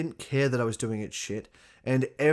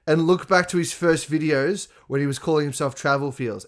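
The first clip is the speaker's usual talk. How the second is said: ordinary delivery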